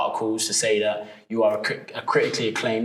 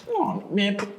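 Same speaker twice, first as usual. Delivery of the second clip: easygoing director voice, pitched up; Gerard McMurray, relaxed director; 6 semitones higher, faster than usual. Retold or imitated. Director offers actor the role on a call, relaxed imitation. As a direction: wave it all away casually in a higher put-on voice. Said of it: "he's so relaxed".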